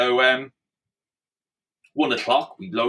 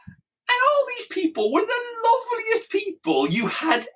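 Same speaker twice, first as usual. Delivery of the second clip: ordinary delivery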